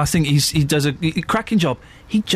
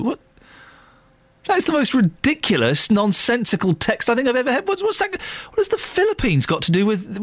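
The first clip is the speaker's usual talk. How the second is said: high, indignant disbelief; incredulous; 6 semitones higher than usual. Real, not acted. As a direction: rant at a silly text. Radio host reacts to a listener text.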